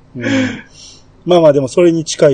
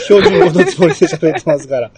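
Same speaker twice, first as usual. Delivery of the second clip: talking through laughter; laughing protest; 4 dB louder than usual. Real, not acted. Defending own speech to the co-hosts, laughing. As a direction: protest with laughter breaking into the words.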